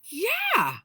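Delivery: high, loud, drawn-out yeah; animated yes. Retold or imitated. Little being cheerfully confirming, loud and high. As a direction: burst out with a lively yes. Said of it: "like, animated".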